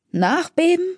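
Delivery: high, rising startled echo of Nachbeben; alarmed question. A narrator's reading. Deacon startled by Gable's aftershock warning, high rising question. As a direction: snap the word Nachbeben back as a startled question.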